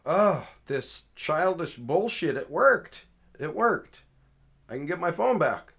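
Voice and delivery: high, loud retold crowing; gleeful disbelief. Caller crows to officer that his messages worked, gleeful. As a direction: crow it with rising pitch.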